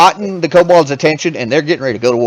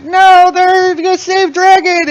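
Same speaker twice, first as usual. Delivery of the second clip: high squeaky mock kobold; 3 dB louder than usual. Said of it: "You're like".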